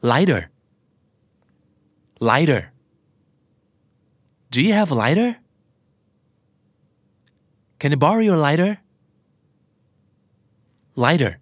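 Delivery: "lighter" said like "lider"; American flap T. Shown as a demonstration. Teacher drilling learners with questions, flap T. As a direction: tap the T in "lighter" as a D. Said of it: "T ที่อยู่กลางคำแล้วกลายเป็นเสียงตัว D".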